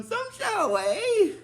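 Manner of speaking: very high, slow villainess impression; swooping falsetto taunt; Discordia. Imitating a villainess taunting fallen Rangers, high and drawn-out. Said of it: "she's telling them".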